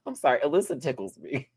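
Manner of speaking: ordinary delivery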